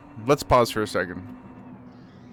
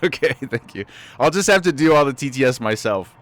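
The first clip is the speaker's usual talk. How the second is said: ordinary talk, nothing marked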